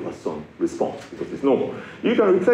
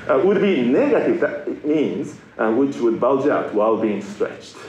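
ordinary talk

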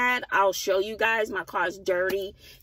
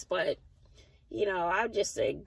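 ordinary talk

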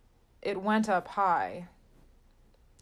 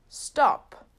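loud, higher urgent shout; shouted warning; 6 semitones higher, 5 dB louder than usual. A narrator's reading. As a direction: yell to stop them from a distance. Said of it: "A man said".

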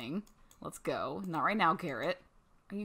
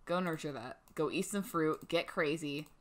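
usual speaking voice

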